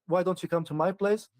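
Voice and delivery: ordinary talk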